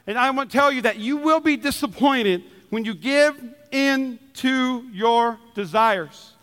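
ordinary talk

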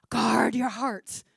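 slow, hushed parental warning; whispered warning. Retold or imitated. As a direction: whisper it like a secret to a teen.

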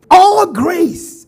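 "All grace" shouted high and loud; exclaimed emphasis. Real, not acted. Preacher exclaims the promise of grace to the church, loud and high. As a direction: fling out "All grace" to the congregation. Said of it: "emphatic repetition".

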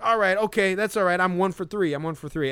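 high, loud, chipper self-consoling; mock upbeat analyst. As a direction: cheer yourself up like a batter who went hitless. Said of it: "Harold Reynolds was like".

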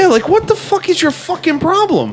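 very high-pitched mock outrage; exasperated outburst. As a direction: demand it in high, incredulous exasperation. Imagined retort to the cop.

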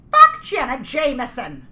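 slow, loud soccer mom curse; a cute mom snapping out a curse; adorable soccer mom. Retold or imitated. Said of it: "like this little adorable Buffalo soccer mom".

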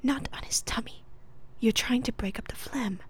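acted stage whisper. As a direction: whisper it ASMR-style. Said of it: "this is all in whisper".

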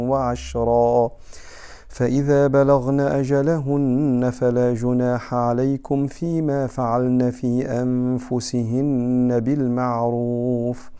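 slow, sustained tajweed recitation; solemn chanted Quranic reading, very slow. Acted. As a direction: recite the verse measuredly with long drawn-out vowels.

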